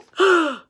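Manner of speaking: sharp loud gasp; big surprised gasp for the class. Acted. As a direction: gasp in mock alarm. Teacher reacts to shark fin.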